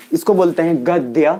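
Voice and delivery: गद्य बोलें, द और य जुड़े, गद्-य; गद्य में द के साथ य साफ बोलिए; correct gadya. Shown as a demonstration. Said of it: "मैंने बोला गद्य". (गद्य को द्य के साथ बोलो)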